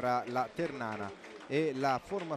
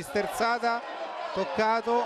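excited shout; 6 semitones higher than usual. Real, not acted. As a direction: yell out the foul. Foul claim in live commentary. (grido concitato sul contatto)

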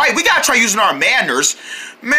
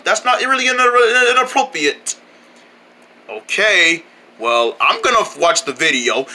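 usual speaking voice

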